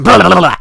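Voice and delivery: mock worrying growl. Acting out the drop bear chewing his shoe, a mock dog growl. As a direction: shake the shoe in your teeth and grumble. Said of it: "and he's going".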